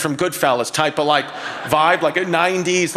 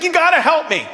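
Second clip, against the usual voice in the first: pleading wife; 10 semitones higher than usual. Retold or imitated. Wife begging the therapist to save her dog. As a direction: beg like a frantic wife. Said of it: "she's like".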